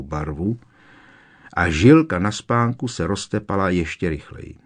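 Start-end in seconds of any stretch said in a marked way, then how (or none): none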